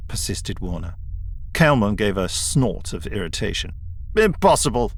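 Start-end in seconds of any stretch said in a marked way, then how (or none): 4.2-4.9 lofty. A narrator's reading. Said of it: "he said loftily".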